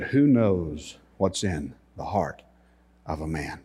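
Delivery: softly imitated Israeli tour guide; Israeli accent. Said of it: "in his Israeli accent".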